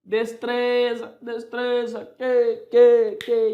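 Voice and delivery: high, loud pretend pet call; calling a dog. Teacher pretends calling third puppy to eat, calling out. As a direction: call it out, stretching the stressed syllable. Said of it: "vai chamá-las para dar ração".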